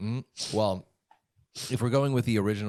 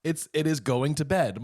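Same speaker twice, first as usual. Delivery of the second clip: ordinary talk, nothing marked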